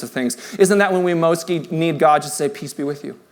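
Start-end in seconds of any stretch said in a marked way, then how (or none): 2.5-3.2 very soft, low blessing; gentle God. Acted. God to someone broken apart, softly reassuring. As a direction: drop to a hushed, tender reassurance.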